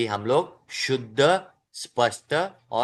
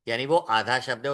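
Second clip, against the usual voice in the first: ordinary talk, nothing marked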